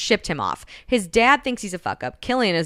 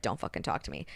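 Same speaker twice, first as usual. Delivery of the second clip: low, hushed acted annoyance; quiet anger; 10 semitones lower, 11 dB quieter, faster than usual. She is super annoyed with him, low quiet anger. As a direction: shut them out under your breath.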